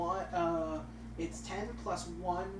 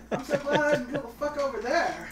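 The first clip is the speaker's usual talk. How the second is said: laughing mock relief; 8 semitones higher, 8 dB louder than usual. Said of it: "the waitress is like".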